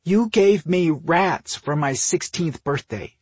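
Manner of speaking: high, slow, loud accusation; pointed reminder. A narrator's reading. Sister reminding brother of rats, pointed. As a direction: spell it out pointedly. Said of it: "she reminded him".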